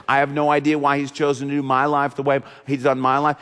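usual speaking voice

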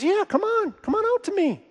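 very high, light beckoning voice; beckoning call. Retold or imitated. Jesus inviting Peter onto the water, high beckoning call.

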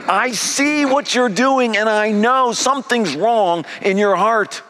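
high, loud, driving sermon voice; full-force preaching. Acted. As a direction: preach it out with rising fire.

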